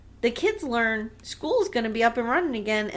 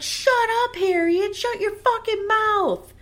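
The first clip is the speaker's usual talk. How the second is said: high, hammed-up outburst, drawn out at the end; mock angry yell; 11 semitones higher, 4 dB louder, slower than usual. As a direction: play a husband yelling his wife down. Husband silencing wife in acted quarrel.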